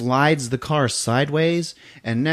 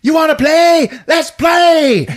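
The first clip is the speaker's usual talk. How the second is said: high, loud maniac challenge; hammed-up taunting yell; imitated scream; 6 dB louder, slower than usual. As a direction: scream it like a crazed villain. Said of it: "he screams".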